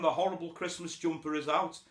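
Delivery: normal talking voice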